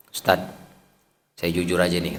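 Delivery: low, soft imitated admission; hushed confession. Tough man confessing privately to the ustaz, low and hushed. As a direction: play a man quietly owning up. Acting a man's embarrassed confession.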